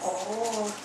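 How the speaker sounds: usual speaking voice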